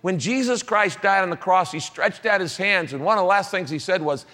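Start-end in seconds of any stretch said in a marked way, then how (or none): none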